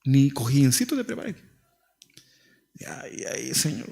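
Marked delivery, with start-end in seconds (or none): 2.7-3.9 hushed mock prayer whisper. Preacher mocks a pastor's posed prayer photo in a whisper. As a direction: breathe it like a staged private prayer.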